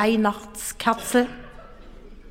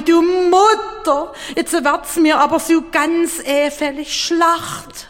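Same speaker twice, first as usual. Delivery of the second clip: jammernder Betrunkener; 7 semitones higher than usual. Acted. Betrunkener Richard, ihm wird übel.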